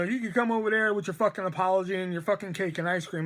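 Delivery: ordinary talk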